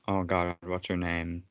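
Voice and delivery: ordinary delivery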